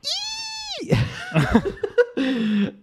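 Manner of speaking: long, very high-pitched wail after a gasp; high drawn-out comic whine; mock despairing squeal.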